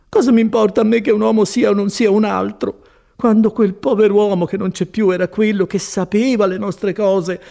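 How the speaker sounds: high, exasperated lament; impatient Agnese. A narrator's reading. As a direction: protest impatiently as the disappointed woman. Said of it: "esclamò Agnese con quel misto di gratitudine e di impazienza".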